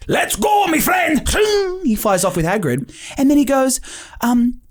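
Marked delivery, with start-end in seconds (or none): 0.1-1.3 jolly ride staffer. Retold or imitated. Staffer sending boyfriend off on the ride, high hearty call. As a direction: cheer the rider off like a pirate-ish guide. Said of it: "Hagrid ride staffer sends boyfriend off".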